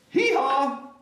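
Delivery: high, loud braying hee-haw; donkey bray. Acted. Reading to children, loud high donkey hee-haw.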